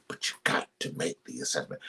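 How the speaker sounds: preacher whispers the key point. Real, not acted. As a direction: lean in and whisper it. Whispered emphasis.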